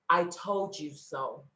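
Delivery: low, slow, knowing delivery; inner voice's I-told-you-so. Acted. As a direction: let it drop, slow and measured, as a quiet rebuke. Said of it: "you will hear a voice that will say".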